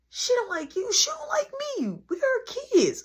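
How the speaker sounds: high whiny little brother; a lightly voiced whiny kid brother. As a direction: whine it like a put-upon kid. Brother whining about mom.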